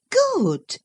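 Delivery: one sharp word; cried out. Acted. Catherine crying out at Isabella, high and sharp. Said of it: "cried the other".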